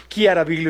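Chiaraviglio restated firmly with a K, "Kiaraviglio"; corrected hard K. Shown as a demonstration. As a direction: correct yourself: Chiaraviglio opens "kia". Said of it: "estoy corrigiendo como le digo".

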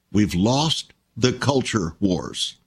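slow, weighty, stress on lost; slow emphatic repeat. Shown as a demonstration. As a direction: let each word land slowly, leaning on lost.

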